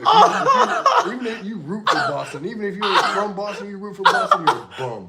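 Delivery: loud, laughing mock Boston accent. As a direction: ham up a thick Boston accent, rising to a yell. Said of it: "that was the greatest accent".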